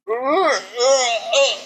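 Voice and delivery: high, halting mock stammer; stammering player. Tony caught by campus police, high sheepish stammer. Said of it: "And he's like".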